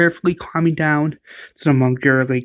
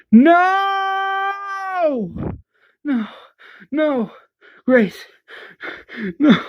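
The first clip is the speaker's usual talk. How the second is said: anguished screams; 12 semitones higher, much slower than usual. Retold or imitated. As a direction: scream in grief over Grace. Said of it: "Morgan screams".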